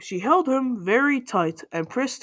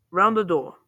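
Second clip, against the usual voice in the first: ordinary delivery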